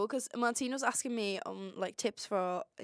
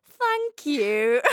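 loud, higher British-style thank-you; mock British accent; 4 semitones higher, 10 dB louder than usual. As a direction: imitate her put-on British accent, loud. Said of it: "Martino was gonna thank the waiter".

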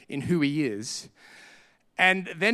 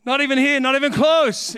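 higher and louder, fed up with the search; fed-up househunter's raised cry; exasperated outburst; 8 semitones higher, 5 dB louder than usual. Retold or imitated. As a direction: throw up your hands in the retelling.

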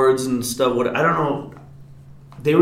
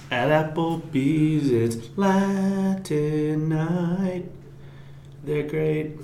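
mock radio ad voice; 3 semitones higher, 8 dB quieter, much slower than usual. Mock ad for Latin night.

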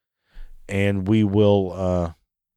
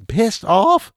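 high, loud disbelieving laugh-yell; incredulous laugh; 12 semitones higher, 5 dB louder than usual. Real, not acted. Ranting about the offended intern, incredulous high laughing yell.

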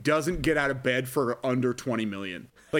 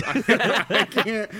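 laughing; 7 semitones higher, 4 dB louder, much slower than usual. Real, not acted. Laughing at the hated toast.

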